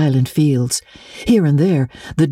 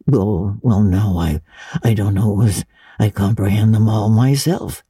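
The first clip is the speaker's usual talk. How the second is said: a lightly voiced, lower, hesitant old farmer; shy old Matthew; 5 semitones lower than usual. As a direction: mumble like a bashful old man.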